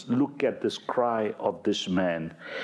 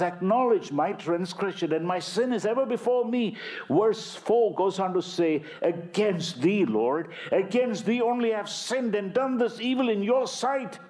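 far higher, pleading and stretched; high, passionate confession; anguished psalm reading; 9 semitones higher than usual. Acted. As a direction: cry the confession out to God. Preacher reads psalm aloud.

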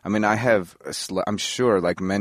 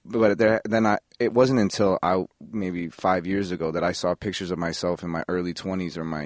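usual speaking voice

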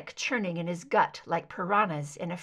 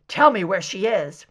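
angry; 9 dB louder than usual. Acted. Mulder demanding angrily.

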